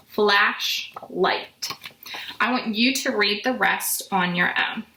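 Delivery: ordinary talk, nothing marked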